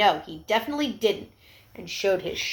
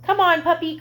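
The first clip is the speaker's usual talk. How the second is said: higher, louder happy call; excited child's call; 9 semitones higher, 7 dB louder than usual. Acted. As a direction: call the puppy in gladly. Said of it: "cried the boy".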